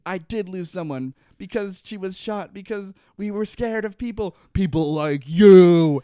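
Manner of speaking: theatrical rage exploding on the last words; building acted fury. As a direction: build from pained intensity into a huge shout. Acts Saeed's grief turning to fury.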